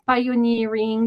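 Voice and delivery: ordinary talk, nothing marked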